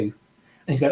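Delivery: normal talking voice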